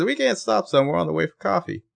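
high, Australian attempt that slides into Southern; failed Australian accent. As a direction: aim for Australian and drift Southern. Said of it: "It sounded southern".

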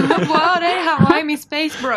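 high, loud, slow laughing wail; mock wail. Friend joins the joke, high laughing wail. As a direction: cry out for the lost bro, laughing.

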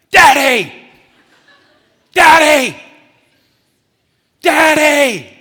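loud, high-pitched yell for Daddy; imitated child's shout, long and piercing; child crying out. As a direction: holler like a little kid calling from bed at night. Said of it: "I would hear Mac cry out".

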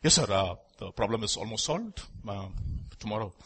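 low, soft, hesitant excuse; timid employee. Retold or imitated. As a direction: mumble excuses to the boss. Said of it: "when your boss comes".

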